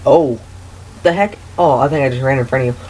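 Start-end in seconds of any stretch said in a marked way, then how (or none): none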